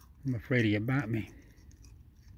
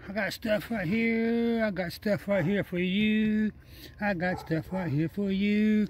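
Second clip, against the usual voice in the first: slow, higher sing-song coax; 6 semitones higher, slower than usual. Real, not acted. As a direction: lure a wild animal gently, slowly. Coaxing the raccoon with food.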